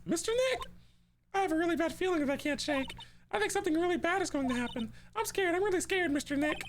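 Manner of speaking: put-on high frightened child voice; high girlish fearful voice; scared little girl. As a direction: speak like a scared small girl. Voicing scared child Pearl.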